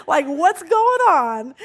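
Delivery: loud, high, drawn-out comic question; amused mock concern. Stranger asking the distraught student, loud comic mock concern. Woman's question retold, laughing.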